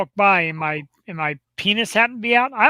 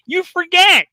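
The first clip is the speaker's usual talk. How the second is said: ordinary delivery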